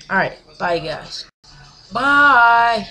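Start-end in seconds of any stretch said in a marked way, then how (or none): 1.9-2.9 a playful extra goodbye shout; loud bye. Real, not acted. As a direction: call out the goodbye again. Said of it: "You already said bye".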